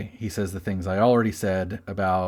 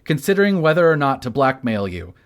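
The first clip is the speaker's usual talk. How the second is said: higher, emphatic quote reading; all caps reading; 5 semitones higher, 3 dB louder than usual. Acted. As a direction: read it out like it's typed in capitals. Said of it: "All caps".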